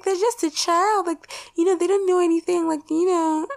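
high-pitched mock parent voice; dismissive parent. Parent excusing a naked child to the speaker, high mocking voice.

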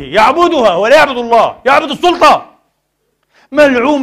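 loud, rising into a high cry; outraged condemnation. Real, not acted. Preacher denouncing a ruler who worships power, outraged shout. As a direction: hammer the accusation home.

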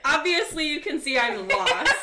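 loud, laughing mock exasperation; half-laughing, throw it at him loudly; exasperated yell. To the guard following her, loud laughing exasperation.

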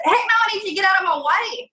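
high, feisty comeback; mock-indignant high retort; sassy retort. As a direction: toss it back with cheeky defiance. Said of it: "I said".

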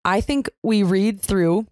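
usual speaking voice

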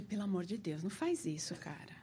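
hushed pleading whisper; whispered retold plea; frightened whisper. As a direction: beg him in a whisper. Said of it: "Eu falei".

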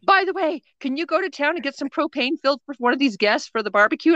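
high, quick, casual request; breezy boss voice. Retold or imitated. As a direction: rattle off the errand brightly. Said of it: "manager voiced in retold scene".

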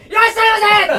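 high, loud shop greeting; bright loud shop welcome. Shown as a demonstration. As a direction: belt out a shopkeeper's welcome. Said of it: "大きな声で". (大きな声で元気にいらっしゃいませ)

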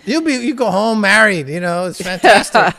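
high, loud and carried away; excited pitch. Real, not acted. Host promising lonely men quick romance, excited pitch. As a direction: hype it like a can't-lose offer.

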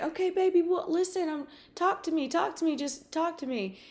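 high-pitched pleading mother voice; mom coaxing. Retold or imitated. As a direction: imitate a mother urging her son. Voices mom coaxing her son.

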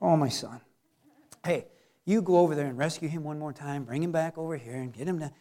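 low, quiet fatherly voice; patient God. Acted. God sending someone to rescue His son, low and patient. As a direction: speak low and soft, as a long-suffering father.

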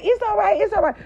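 high, loud mock soothing; high mock reassurance; mocking nurse. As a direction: parody the nurse's fake calm. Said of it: "She like".

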